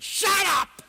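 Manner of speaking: sudden loud, high yell; retold shout. Snapping at the self-absorbed student on the plane, a mock loud yell. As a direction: bark it at the whining kid.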